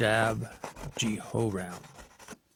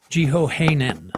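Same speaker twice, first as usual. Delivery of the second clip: ordinary delivery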